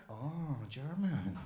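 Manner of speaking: soft, low, drawn-out realisation; subdued shopkeeper. Retold or imitated. Doner guy takes in customer's answer, subdued. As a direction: stretch it out, hushed.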